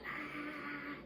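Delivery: high short mock scream; retold scream. Being choked in the car, short high scream. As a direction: let out a high yelp. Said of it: "and I'm screaming".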